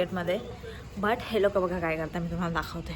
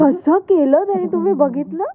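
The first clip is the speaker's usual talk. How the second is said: excited; 8 semitones higher, 12 dB louder than usual. Real, not acted. Rooster crowing, speaker delighted.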